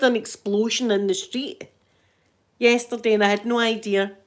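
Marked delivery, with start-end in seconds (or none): none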